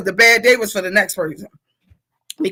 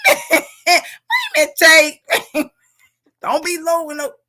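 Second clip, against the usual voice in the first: high, laughing protest; laughing high wait a minute; 7 semitones higher than usual. Real, not acted. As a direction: laugh while warning her off. Laughing at a viewer's comment.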